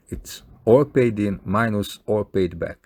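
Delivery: ordinary talk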